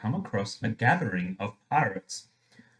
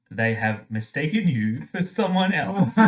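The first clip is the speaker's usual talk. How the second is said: normal talking voice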